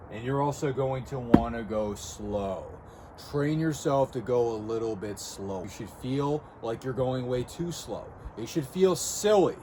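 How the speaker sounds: slow, drawn-out coaching delivery; deliberately slow. Shown as a demonstration. Coach showing viewers how slow to go, deliberately slow. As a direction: stretch it out, going slow. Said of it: "If that is how slow you're going".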